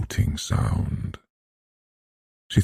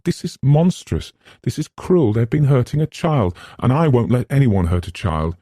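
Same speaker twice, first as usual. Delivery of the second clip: higher, louder indignation; outraged; 8 semitones higher, 4 dB louder than usual. Acted. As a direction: refuse to let a child be hurt. Eleanor's outrage.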